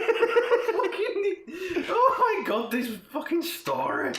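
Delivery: high-pitched words squeezed out through laughter; helpless laughter. Real, not acted. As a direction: barely get it out while cracking up. Cracking up at the story.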